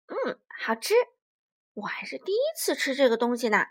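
fast breathy mouthful; T-rex tasting shellfish for the first time, munching; talking while munching. Acted. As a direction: chew and talk quickly.

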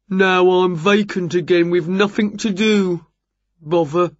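workman character voice; builder Jerry, glum. A narrator's reading. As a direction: grumble as a builder left idle.

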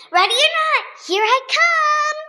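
high, loud hide-and-seek shout; shouted call. A narrator's reading. Doc calling to friends in hide and seek, shouting. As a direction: call it out high, stretching the last word. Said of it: "shouts Doc McStuffins".

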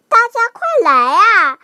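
high, loud, quick excited call; happy shout. A narrator's reading. Piglet calling friends to the sweet rain, happy shout. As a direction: call everyone over with joyful urgency. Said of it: "开心地大".

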